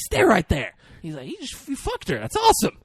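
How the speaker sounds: high, thrilled mock praise; excited. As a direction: cheer him on like a proud buddy. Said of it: "he's happy for him".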